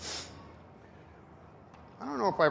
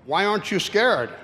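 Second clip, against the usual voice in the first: higher, louder devil voice; Satan demanding; 5 semitones higher, 7 dB louder than usual. Acted. Satan demands why the cowboy isn't scared, loud and high.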